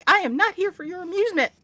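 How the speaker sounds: loud, high, quick protest; mock indignant. Speaker to amused Westerners, loud mock indignation. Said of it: "I'm like".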